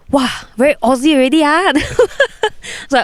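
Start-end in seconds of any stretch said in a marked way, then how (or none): 0.1-1.7 put-on fast, high Malaysian-accented tease; quick teasing Malaysian English. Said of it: "He was like". 1.8-2.8 high giggle; high laugh. Real, not acted. Laughing at the retold tease.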